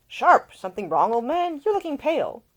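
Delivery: high, loud mocking young gent; younger son teasing. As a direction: call out to Nick with cheeky concern. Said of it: "the man who had spoken".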